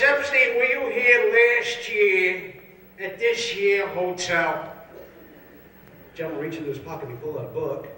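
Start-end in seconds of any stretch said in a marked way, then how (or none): 0.0-4.7 slow, high interrogation. Acted. Stranger interrogates Epstein.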